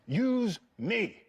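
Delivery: high, emphatic, theatrical command; James. Retold or imitated. Co-star urging young actor to focus on him, commanding. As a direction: offer yourself as his anchor, firmly. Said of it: "he was like".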